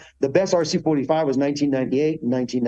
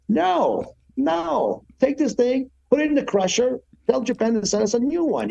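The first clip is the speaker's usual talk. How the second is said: high-pitched, incredulous refusal, pitch jumped way up; half-laughing disbelief, brushing the idea off; exasperated no; 10 semitones higher than usual. Retold or imitated. Rider scoffing at the mechanics' sticker idea, high and incredulous.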